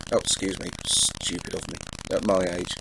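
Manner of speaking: dropped low and soft after shouting; quiet, abashed self-reproach; sheepish apology. Real, not acted. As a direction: excuse your outburst, embarrassed, almost to yourself. Embarrassed after his own shout.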